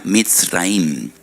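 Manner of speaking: "Mitzraim" said slowly in Hebrew, syllable by syllable; Hebrew pronunciation. Shown as a demonstration. Preacher shows listeners how Egypt is said in Hebrew, slow careful "Mitzraim". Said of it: "se pronuncia".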